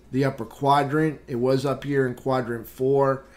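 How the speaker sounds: usual speaking voice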